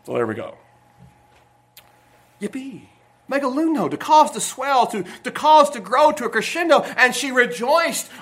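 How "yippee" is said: much softer, understated yippee; subdued cheer. Shown as a demonstration. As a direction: give a small, muted cheer. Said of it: "I don't want quite that loud".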